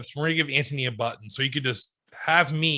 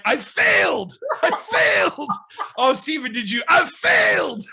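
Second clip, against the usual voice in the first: mock wailing shout; 8 semitones higher than usual. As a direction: wail it like a hammy soundbite. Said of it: "every week you just hit the soundbite".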